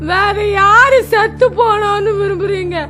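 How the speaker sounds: tearful keening over a lost son; wailing lament. Acted. Mother wails over her dead son, tearful lament. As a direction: mourn aloud, voice breaking now and then.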